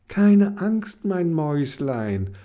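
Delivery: soothing, high and slow; calm the little cyborg gently; reassuring. Acted. Kenny comforts her crying little cyborg, soothing. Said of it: "beruhigte ihn Kenny".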